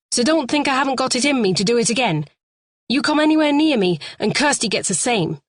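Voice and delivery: fast, high warning; heated threat. A narrator's reading. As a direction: rush the threat out. Lee threatens Terry.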